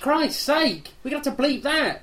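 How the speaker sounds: a real yelp of outrage on air; shocked outburst. Real, not acted. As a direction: blurt it out in shock at the rude word. Co-host swears on air.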